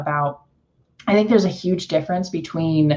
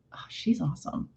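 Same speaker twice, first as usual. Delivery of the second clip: flat muted praise; 13 dB quieter than usual. Retold or imitated. Praising someone she knows in a flat whisper. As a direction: say it barely there, unimpressed. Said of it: "Those mean exactly the same thing to me".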